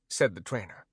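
plain reading voice

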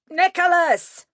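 very high, loud call; bellowing a name from afar; shout. Acted. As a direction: yell his name across the street. Mage shouting for demon.

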